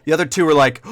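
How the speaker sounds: ordinary delivery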